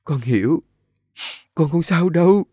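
tearful, rising high in pitch; sobbing. A narrator's reading. Nhã Phương comforts the old woman, sobbing. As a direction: reassure her through tears. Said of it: "ôm lấy bà cụ nức nở".

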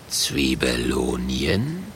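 low, slow suspicious growl. A narrator's reading. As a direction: growl the word out slowly. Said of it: "knurrt der Murk".

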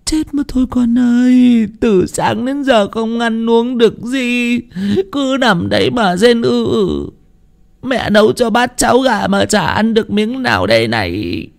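high, slow, tearful wifely lament. Acted. Wife fretting over her bedridden husband who won't eat, tearful lament. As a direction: wail it in long, high, drawn-out phrases. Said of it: "nước mắt tèm lem".

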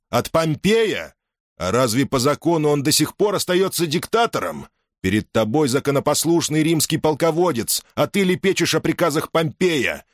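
high, incredulous, contemptuous commander; scornful commanding general. Acted. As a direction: scorn him as the victor. Caesar mocks and dismisses him.

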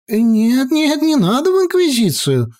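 put-on high, flustered old wizard; startled and fussing. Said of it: "сполошился маэстро".

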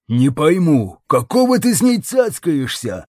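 irritated, rising grumble; angry growl. A narrator's reading. Lom questioning Jackson's patience with her, angry growl. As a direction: demand it with mild snarling annoyance. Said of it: "злобно прорычал Лом".

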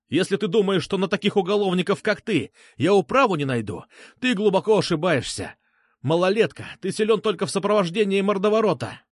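high-pitched indignant threatener; blustering Petrushka. Acted. Petrushka threatens the narrator with the law, blustering.

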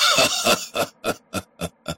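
soft breathy chuckle of triumph; gleeful laugh. Acted. The pasha chuckling in triumph over his win. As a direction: laugh quietly at your own good fortune.

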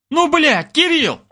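high-pitched exasperated bellow; angry bark. A narrator's reading. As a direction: roar at a bandmate who ruined the take. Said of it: "рявкал он".

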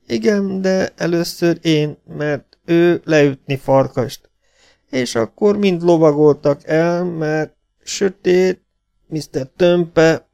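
high, slow broken speech; wounded half-breed. Acted. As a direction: drag each word out in pain. (magas, lassú, tört beszédű sebesült)

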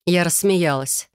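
usual speaking voice

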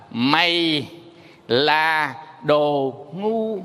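"Mày là đồ ngu" drawn out word by word, stepping downward then level; falling-tone demo. Shown as a demonstration. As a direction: stretch "Mày là đồ ngu" slowly to show each tone. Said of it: "bắt đầu nó có xuống, xuống, xuống, ngang". (đọc chậm từng chữ "Mày là đồ ngu", hạ giọng rồi ngang)

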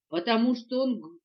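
usual speaking voice

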